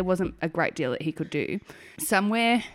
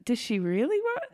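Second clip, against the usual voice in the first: high, softer doubtful aside; sceptical midwife; 6 dB quieter than usual. Retold or imitated. Midwives doubting her plea, high soft sceptical murmur. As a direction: wonder aloud if she means it. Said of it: "the midwives are looking at me like".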